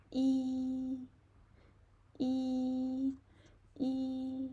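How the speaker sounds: teacher models the first tone on yi. Shown as a demonstration. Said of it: "So for the first tone".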